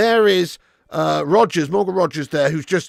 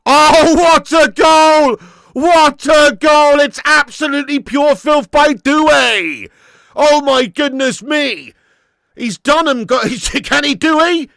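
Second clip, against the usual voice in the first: ecstatic goal call; 7 semitones higher, 8 dB louder than usual. Real, not acted. Commentator calling Doué's equaliser, ecstatic shout. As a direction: bellow in amazement, then keep the call loud and high.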